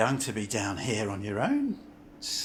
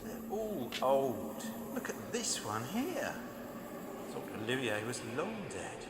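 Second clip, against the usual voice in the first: muttered to himself; 9 dB quieter than usual. Acted. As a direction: mutter it under your breath. Muttering while reading old posters.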